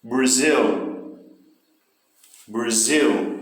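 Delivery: BRAZIL with a long, strong final syllable; stress on last syllable. Shown as a demonstration. Teacher drilling syllable stress for students, last syllable of Brazil stressed. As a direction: lean on the end of "Brazil". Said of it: "Justamente o final da palavra".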